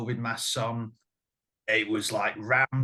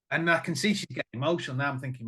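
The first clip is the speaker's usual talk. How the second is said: ordinary talk, nothing marked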